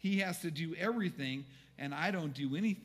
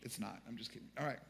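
normal talking voice

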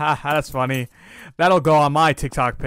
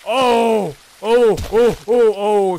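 high, loud startled oh-oh cries; panicked cries; 6 semitones higher, 7 dB louder, slower than usual. Real, not acted. Player yelps as the demon hits him, panicked cries. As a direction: yelp as the demon catches you.

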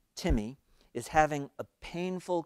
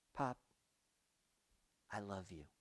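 much softer, lower, simply offered; hushed tender; 4 semitones lower, 16 dB quieter than usual. A narrator's reading. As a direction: let it fall quietly and gently. Son says I love you.

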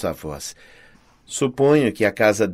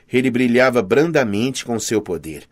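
ordinary delivery

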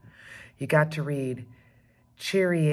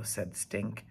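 usual speaking voice

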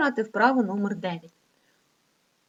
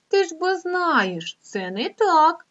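higher, slow "it's not so"; slow teacherly correction; 8 semitones higher, 3 dB louder, much slower than usual. Shown as a demonstration. Teacher telling pupils the poem is wrong, slow and high. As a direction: gently set the children straight, slowly.

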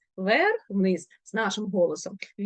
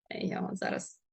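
soft conspiratorial whisper; hushed teacher aside; 11 dB quieter than usual. Real, not acted. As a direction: lean in and whisper it.

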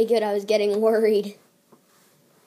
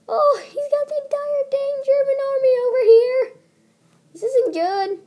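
high fast alarmed outburst; 7 dB louder than usual. Real, not acted. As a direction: blurt it out in alarm. Enemy army appears.

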